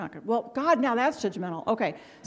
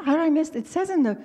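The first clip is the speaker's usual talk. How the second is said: higher-pitched, baffled protest; puzzled objection, pitched up; retold bewilderment; 6 semitones higher, faster than usual. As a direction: replay being thrown and confused. Retelling confusion at professor's rebuke.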